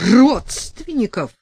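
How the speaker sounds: high, slow, loud outraged cry; furious cry. A narrator's reading. Sasha outraged at being called family, loud furious cry. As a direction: spit the word out in fury. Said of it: "вскрикнула взбешённая Саша".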